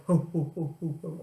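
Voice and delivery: jolly Santa belly laugh. Pretend. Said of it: "feel a bit like Santa".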